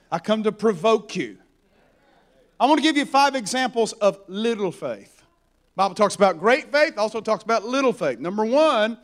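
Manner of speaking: normal talking voice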